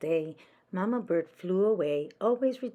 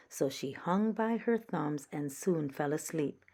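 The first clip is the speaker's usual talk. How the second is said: ordinary delivery, nothing marked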